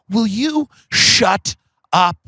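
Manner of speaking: slow, drawn-out mock telling-off; mock exasperation. Late friend silencing his mom, mock exasperation. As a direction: stretch every word in imitated exasperation. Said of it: "one of my favorite things he ever did".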